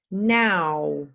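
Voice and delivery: Now said with a wide ow vowel; clear now. Shown as a demonstration. Teacher modelling a minimal pair, Now with a wide ow. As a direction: open the mouth wide on Now. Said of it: "listen carefully and say for yourself".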